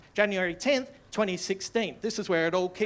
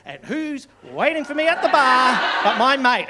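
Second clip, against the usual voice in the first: ordinary delivery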